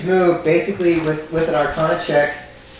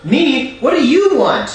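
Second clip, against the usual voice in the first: indignant doppelganger; 10 semitones higher, 4 dB louder, slower than usual. Acted. GM voices doppelganger's retort.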